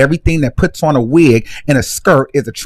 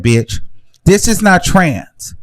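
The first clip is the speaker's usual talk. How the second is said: ordinary talk, nothing marked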